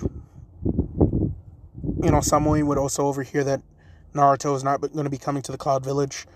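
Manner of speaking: usual speaking voice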